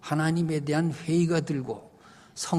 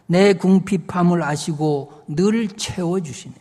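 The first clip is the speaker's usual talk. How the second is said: hymn verse recitation; 6 dB louder than usual. Acted.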